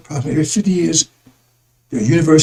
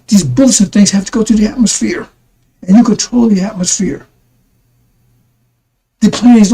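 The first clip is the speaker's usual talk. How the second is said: preaching; 5 semitones higher than usual. Acted. Preaching to brothers and sisters about anger, fervent preacher voice.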